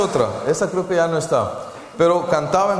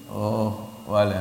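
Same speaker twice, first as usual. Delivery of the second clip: ordinary delivery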